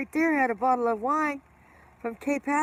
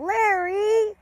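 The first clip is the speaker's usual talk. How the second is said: high, loud mock yell at a husband; imitating wife yelling; 11 semitones higher, 6 dB louder than usual. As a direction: yell the name in a pitched-up wife voice. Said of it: "she started yelling at him".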